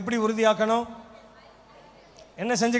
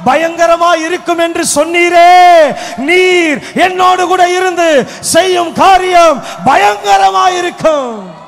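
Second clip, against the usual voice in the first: loud, slow declaration; proclaiming the promise; 5 semitones higher, 11 dB louder, much slower than usual. Shown as a demonstration. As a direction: declare the verse with full force. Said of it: "நான் சொல்லிக்கிட்டே இருக்கிறேன்". (உரத்த அறிக்கை)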